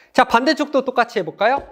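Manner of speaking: usual speaking voice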